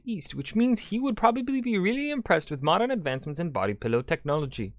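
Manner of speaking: ordinary talk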